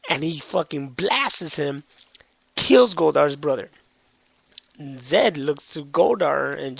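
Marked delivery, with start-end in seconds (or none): none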